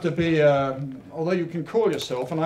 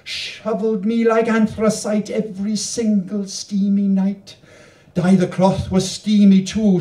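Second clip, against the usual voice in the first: louder, higher, rhythmic verse reading; poem reading; 3 semitones higher, 5 dB louder, slower than usual. Acted. Poet reading her Welsh canto aloud to the audience, louder and higher. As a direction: perform the canto with bouncing rhyme.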